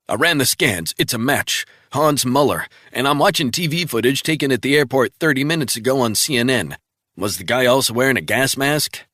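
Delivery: crisp agent reporting in; colleague on the phone. Acted. Travell briskly reporting in to Resnick by phone.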